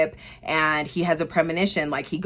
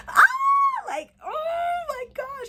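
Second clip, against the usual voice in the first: excited squeal; slower than usual. Real, not acted. Excited about the movie now.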